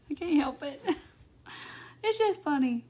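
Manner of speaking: breathless giggle through the words; real laughter, words half-whispered; laughing helplessly. Real, not acted. Giggling at googly-eyed statue.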